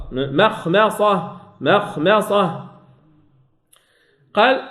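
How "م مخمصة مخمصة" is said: مخمصة letter by letter, very slow; slow clear reading. Shown as a demonstration. Teacher models مخمصة slowly for students, careful. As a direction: give each letter of مخمصة its time. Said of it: "لما أقول من مخمصة غلط".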